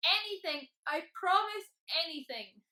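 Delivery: loud, high, desperate dragon plea; pleading. A narrator's reading. Tickled dragon bargains with Mister Tickle, loud pleading. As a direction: beg at full voice, stretching the words. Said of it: "pleaded the dragon".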